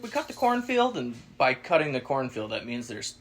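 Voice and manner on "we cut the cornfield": put-on lightly raised old lady voice; old lady's voice. Landowner lady explaining the cut field, lightly voiced. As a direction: keep her easygoing lilt. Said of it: "She's like".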